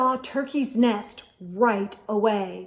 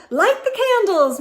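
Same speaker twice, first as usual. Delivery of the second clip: brisk hostess; 10 semitones higher, 8 dB louder, faster than usual. A narrator's reading. Hostess orders.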